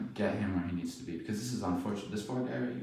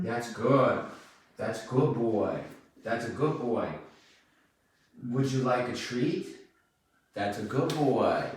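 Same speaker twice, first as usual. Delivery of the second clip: slow, soothing praise; praising a dog; 3 semitones higher, 3 dB louder, slower than usual. Real, not acted.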